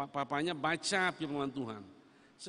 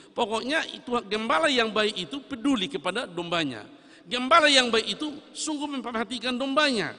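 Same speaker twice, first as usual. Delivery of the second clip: louder, higher preacher's swell; forceful preaching; 6 semitones higher, 10 dB louder than usual. Acted. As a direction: drive the point home to the pews. Preacher raising voice on shepherd.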